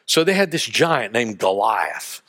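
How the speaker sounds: ordinary talk, nothing marked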